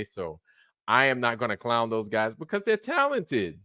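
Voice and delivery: ordinary talk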